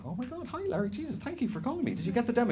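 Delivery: high, breathless gushing fan. Retold or imitated. Gushing to the drummer on the phone, excited. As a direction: fawn over the rock star on the phone. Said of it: "I went".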